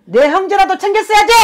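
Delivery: sudden high, loud cry of grievance; anguished reproach. Acted. Shaman voicing the dead sister-in-law to her family, crying out high and loud. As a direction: shout the blame out as the wronged spirit.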